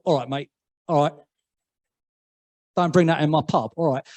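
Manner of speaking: a hint of an EastEnders Cockney pub landlord; lightly put-on London pub accent, firm; mild EastEnders London accent telling someone off. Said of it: "my accent has gone a little bit more".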